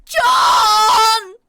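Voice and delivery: high, screaming cry for help; terrified yell. A narrator's reading. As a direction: scream his name in panic. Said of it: "I yelled".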